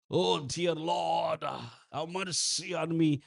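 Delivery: very slow, drawn-out show of pleading prayer; mock English prayer. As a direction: put on a stylish, long-stretched plea to God. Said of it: "ఒక ఉదాహరణకి ఒకవేళ ఇంగ్లీష్లో మనం అన్". (సాగదీసిన నటన ప్రార్థన)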